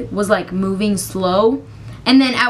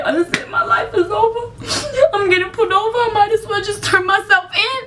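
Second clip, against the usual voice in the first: much higher, half laughing, half crying; laugh-crying despair; 10 semitones higher than usual. Retold or imitated. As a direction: give up dramatically while laughing. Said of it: "I said".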